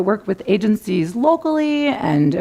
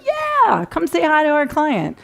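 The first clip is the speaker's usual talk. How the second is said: breezy client; 8 semitones higher than usual. Retold or imitated. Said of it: "They're like".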